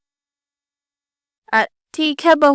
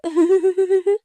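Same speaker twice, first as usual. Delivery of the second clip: giggle; 5 semitones higher, 4 dB quieter than usual. Acted. Daughter-in-law laughs at wrong time.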